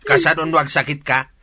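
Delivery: loud, angry berating; father angrily lecturing Jenny about her sin; angry scolding. Acted. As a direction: rain blame down on her.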